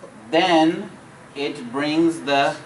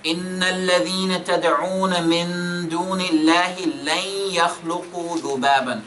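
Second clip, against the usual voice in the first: quick, chanted reading of the verse; fast tajweed chant; Quran recitation; 3 semitones higher, much faster than usual. Shown as a demonstration. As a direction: recite it in a melodic flow. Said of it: "Let me read it again".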